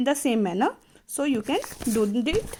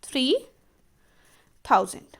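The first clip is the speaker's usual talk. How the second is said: Fifty, three, thousand with long pauses between; teacher spelling out fifty three thousand word by word; spaced out slowly; much faster than usual. Shown as a demonstration. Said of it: "क्या आ जाएगा इसका".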